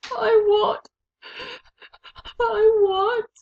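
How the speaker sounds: high, loud repeated wail; desperate high sobbing. A narrator's reading. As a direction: keep crying the words. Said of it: "sobbed Meg".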